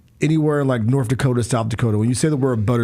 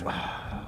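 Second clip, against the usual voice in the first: sigh; 14 dB quieter than usual. Real, not acted. Reacts to Butterbean question.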